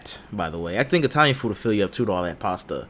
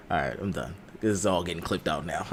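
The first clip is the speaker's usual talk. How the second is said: ordinary talk, nothing marked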